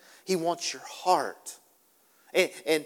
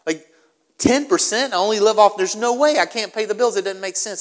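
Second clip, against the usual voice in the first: high, loud mock protest; a hammed-up outraged cry of the reluctant giver; 6 semitones higher, 12 dB louder than usual. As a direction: play the startled giver, much higher and louder. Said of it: "many of you are like".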